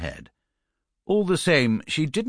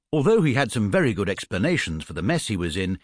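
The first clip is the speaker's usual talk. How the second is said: ordinary delivery, nothing marked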